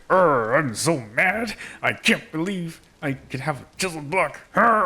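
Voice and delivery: slow gruff pirate growl of mock anger; hammed-up pirate complaint; mock pirate grumble. Builder offering viewers something different as a grumbling pirate. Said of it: "Here, here's something different".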